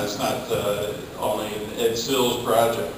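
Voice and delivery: normal talking voice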